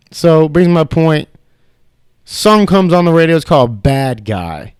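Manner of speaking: ordinary delivery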